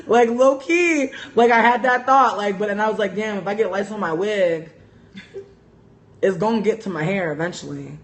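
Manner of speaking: worked up and giggly about the lice thought; excited. Real, not acted. Laughing excited confession.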